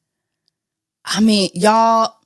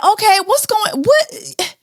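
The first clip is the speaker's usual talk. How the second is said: shocked disbelief; 11 semitones higher, slower than usual. Retold or imitated. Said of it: "I'm just like".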